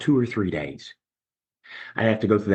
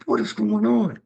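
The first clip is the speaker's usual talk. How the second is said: bewildered; 10 semitones higher than usual. Retold or imitated. Alone, sweating through withdrawal.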